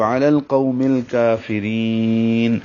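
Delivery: slow recitation with the final madd stretched; recited slowly with a long madd; lengthened pause ending. Shown as a demonstration. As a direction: draw out الکافرین at the stop. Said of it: "اور لمبا کر سکتے ہیں".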